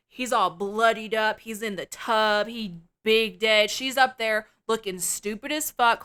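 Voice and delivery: ordinary talk, nothing marked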